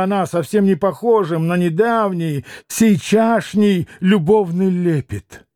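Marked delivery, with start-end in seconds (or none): none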